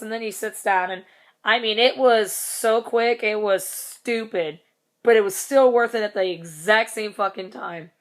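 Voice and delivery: ordinary delivery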